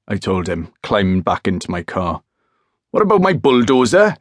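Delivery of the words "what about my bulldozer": high, loud call after a leaving car; shouting after him. Acted. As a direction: yell it after him, indignant. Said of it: "he shouted after me".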